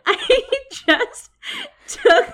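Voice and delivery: giggling high through the words; breaking into embarrassed laughter; laughing. Real, not acted. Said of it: "That's so funny".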